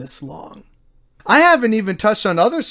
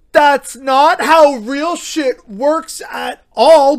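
higher, louder and slow, fed up; exasperated outburst; 7 semitones higher, 5 dB louder, slower than usual. Real, not acted. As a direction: spit it out in disbelief. Ranting at NFT logic.

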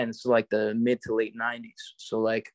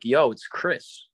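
slow tough caller; much slower than usual. Retold or imitated. Wrestler calling the kid's house, slow mock-menacing greeting. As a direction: drag out the greeting like a thug on the phone. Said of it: "And he's like".

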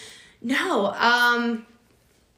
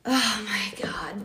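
whispered in disbelief; shocked whisper; much slower than usual. Real, not acted. Hearing about the groom and the maid of honor, stunned whisper. Shocked reaction to wedding story.

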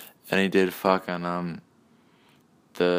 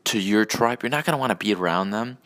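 ordinary talk, nothing marked

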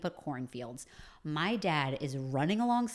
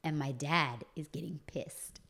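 normal talking voice

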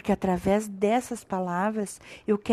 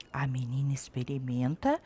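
whisper; 8 semitones lower, 11 dB quieter than usual. A narrator's reading. Storyteller builds suspense.

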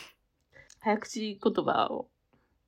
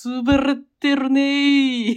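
Anago-san impression; 6 semitones higher, 9 dB louder, much slower than usual. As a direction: talk slow and heavy like Anago. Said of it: "アナゴさんのモノマネで".

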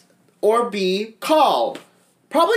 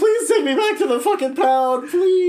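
voicing the dog; 7 semitones higher than usual. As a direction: beg like a miserable starving dog. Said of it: "she's looking up like".

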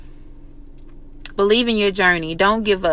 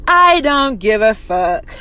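slow, drawn-out mock defiance; exaggerated slow brush-off; defiant comeback; slower than usual. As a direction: stretch each word out, totally dismissive. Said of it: "That should be your response".

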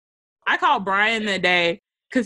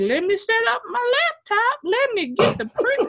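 mock leisurely; 14 semitones higher than usual. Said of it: "going over there like".

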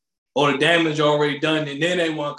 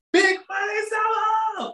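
much higher, slow announcer shout; hype shout; slower than usual. Acted. Host hyping the summer sign-off, big announcer shout. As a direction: call it out like a hype man.

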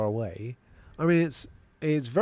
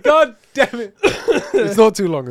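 higher, louder, breaking up with laughter; laughing; 11 semitones higher, 11 dB louder, slower than usual. Real, not acted. Reader cracks up over a listener's comment, laughing. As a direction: laugh through the words.

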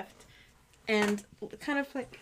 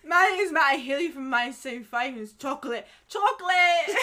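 loud, hammed-up British accent; 3 semitones higher, 5 dB louder than usual. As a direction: introduce yourself as a British rocker, building to a cry. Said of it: "I think that [name]'s in the room".

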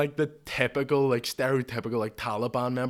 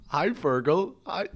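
high, louder imitation of an overeager hello; animated greeting; 6 semitones higher, 5 dB louder, slower than usual. Regular says hi to the barman, animated. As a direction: pipe up like an overfriendly regular. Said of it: "really animated".

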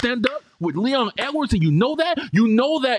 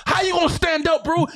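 frustrated fan shouting at fighter; exasperated shout; 9 semitones higher than usual. Real, not acted. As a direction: yell it at the screen in frustration. Heated rant about fighter's plan.

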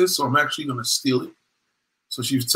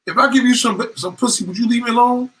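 exasperated offer; 8 semitones higher than usual. Retold or imitated. Woman making an offer.

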